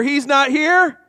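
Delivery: loud, high call for everyone; full-voiced chant lead; rallying call. Shown as a demonstration. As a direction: lead it all together. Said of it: "Everyone together".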